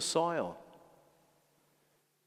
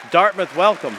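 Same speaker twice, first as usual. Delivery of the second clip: louder, rallying the congregation; big welcome call; rousing call; 2 semitones higher, 10 dB louder than usual. Real, not acted. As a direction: call the crowd to cheer. Priest calls for welcome.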